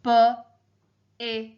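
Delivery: "b, it" as separate sounds with pauses; teacher breaking "b, it" into its sounds; sounding out letters. Shown as a demonstration. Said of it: "help me spell out the word".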